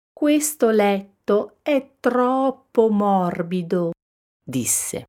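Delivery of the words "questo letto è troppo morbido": higher, lightly whiny Goldilocks; mild complaint. A narrator's reading. Goldilocks trying mama bear's bed, complaining.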